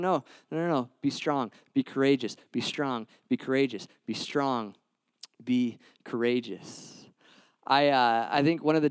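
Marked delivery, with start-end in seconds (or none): 0.0-7.1 soft, fading, reassuring repeats; calm someone's fear gently; gentle reassurance. Retold or imitated. God speaks softly to an anxious believer, reassuring. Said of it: "and God's going".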